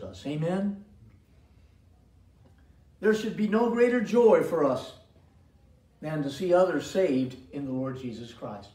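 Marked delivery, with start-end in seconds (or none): 3.0-8.7 preaching. Acted. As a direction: preach it with rising fervour. Preaching at full force.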